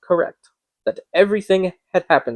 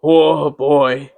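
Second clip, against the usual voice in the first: pitying; 4 dB louder than usual. A narrator's reading. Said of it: "he said in pity for his former self".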